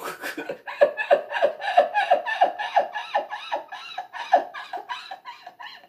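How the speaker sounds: very high, extended laughter; real long fit of laughter; long laughing fit. Real, not acted.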